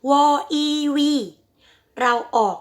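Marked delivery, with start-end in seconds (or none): 0.1-1.1 "วออีวี" spelled out high with a W sound; wrong V sound. Shown as a demonstration. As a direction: demonstrate the wrong "วออีวี", W in place of V. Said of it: "เราไม่ออกเสียง".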